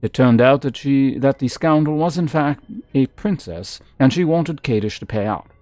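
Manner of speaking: lively read-aloud narrator voice; storybook reading, a touch dramatic; story narration. Acted. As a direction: read the book out like telling a tale. Reads game book aloud.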